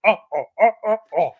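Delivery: loud mock sitcom grunts; a string of loud Home Improvement grunts; Tim Allen grunt. He could do the whole bit.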